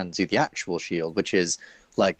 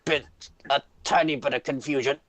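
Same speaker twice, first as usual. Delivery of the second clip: high, halting shopkeeper voice; nervous shopkeeper; 6 semitones higher than usual. Acted. Shopkeeper excusing a mix-up to threatening customer, nervous. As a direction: make excuses haltingly, pitched up.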